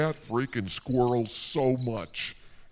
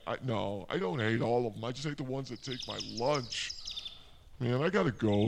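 ordinary delivery

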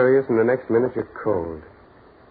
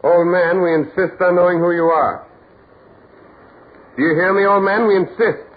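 louder, higher, demanding an answer; insistent shout; 5 semitones higher, 6 dB louder than usual. Acted. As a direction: call out firmly to a man who ignores you. Carl loudly demands old man's name.

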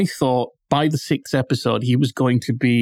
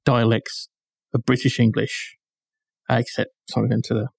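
normal talking voice

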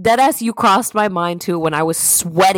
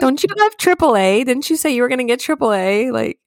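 higher, incredulous scolding of a sister; mock-disbelieving nag, pitched up; exasperated at sister; 8 semitones higher than usual. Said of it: "I call my sister 'cause I'm like".

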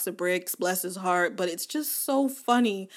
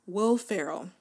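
normal talking voice

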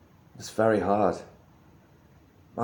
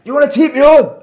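shouted, very high Glaswegian challenge; imitated Glaswegian stranger hollering a challenge; 12 dB louder than usual. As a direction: yell it in a mock Glasgow accent, far louder. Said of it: "And he's like".

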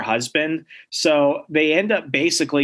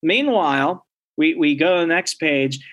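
ordinary talk, nothing marked

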